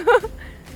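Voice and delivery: high, short burst of laughter; real giggle. Real, not acted. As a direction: let the held laugh escape. Laughs after retry. (высокий короткий смех)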